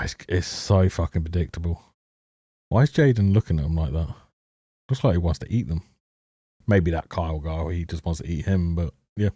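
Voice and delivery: ordinary talk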